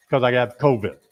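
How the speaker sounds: ordinary talk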